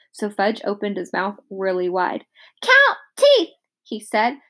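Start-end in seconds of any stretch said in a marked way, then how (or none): 2.6-3.5 put-on very high, louder toddler demand. A narrator's reading. Fudge demanding the dentist count, very high. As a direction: squeal it like a bossy little kid.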